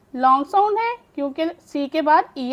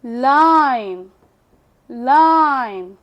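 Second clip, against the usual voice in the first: Line stretched very slowly, low and loud; slow demo; 3 semitones lower, 3 dB louder, much slower than usual. Shown as a demonstration. Teacher to students, slow long-vowel line. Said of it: "आई अपनी long sound".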